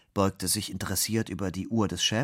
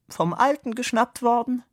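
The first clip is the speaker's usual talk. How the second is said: high, louder silly-colleague voice; 5 dB louder than usual. A narrator's reading. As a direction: pipe up as the silly office mate. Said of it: "der alberne Kollege". (hohe Stimme des albernen Kollegen)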